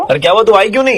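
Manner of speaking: high, loud indignant complaint; annoyed pretend caller. Prank caller loudly scolding the woman for not showing up, high and loud. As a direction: scold her like a fed-up friend waiting.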